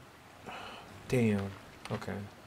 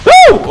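high, loud celebratory whoop; 14 dB louder than usual. Real, not acted. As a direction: cheer as the tree drops. Tree falls, celebrating.

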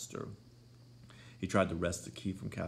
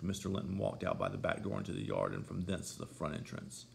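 usual speaking voice

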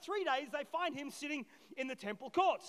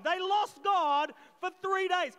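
slow and much louder, pressing each word; emphatic declaration; 5 semitones higher, 8 dB louder, slower than usual. Real, not acted. Preacher hammering the point to church, loud and slow. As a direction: drive it home to the congregation.

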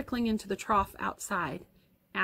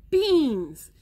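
loud, higher exclamation; yelled realization; 5 semitones higher, 6 dB louder than usual. A narrator's reading. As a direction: burst out with it as it dawns.

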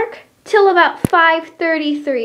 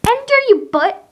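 mock scold; 9 semitones higher, 5 dB louder than usual. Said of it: "I'm joking, not butt".

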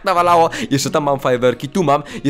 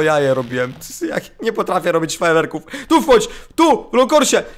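usual speaking voice

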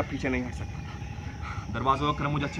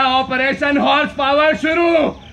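much higher, louder, slow battle cry; inner shout; 11 semitones higher, 11 dB louder, slower than usual. Acted. Narrator shouting the operation's start in his head. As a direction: launch it like a war cry. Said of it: "मन ही मन चिल्लाया".

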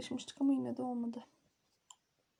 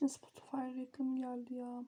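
whispering; 8 dB quieter than usual. Real, not acted. Annoyed whisper about the ad.

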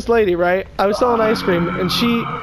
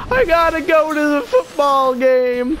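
put-on drunk lady; 6 semitones higher, slower than usual. Drunk lady voiced in story.

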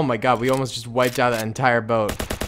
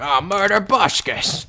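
slow, raised catchphrase impression; Tobuscus; 4 semitones higher, slower than usual. Gamer reminding viewers of a YouTuber's catchphrase, imitating. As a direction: imitate a YouTuber's drawn-out battle cry. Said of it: "Do you guys remember Tobuscus always going".

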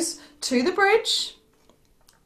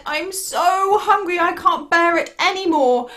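a hint of desperate hunger, higher; hungry little goat; 6 semitones higher, 3 dB louder than usual. Littlest billy goat can't bear the hunger, mildly desperate.

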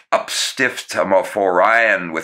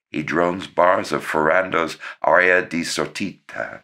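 usual speaking voice

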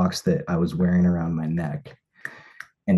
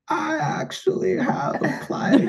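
high, slow, laughing-crying; laughing through sobs; slower than usual. Retold or imitated. Proposing at sunrise, laughing through tears. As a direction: choke it out laughing and weeping. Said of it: "I was sobbing".

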